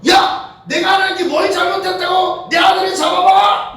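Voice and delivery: put-on loud, high drunken-grief outburst at God; enraged shouting. Bereaved father railing at God over his son, enraged shouting. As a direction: yell it out like a man gone mad. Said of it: "막 소리치면서 돌아다니고".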